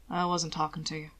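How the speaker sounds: low, soft dismissive mutter; murmured. Acted. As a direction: brush her off under your breath. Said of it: "he murmured".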